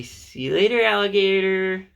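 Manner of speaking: high, loud playful sing-song. Shown as a demonstration.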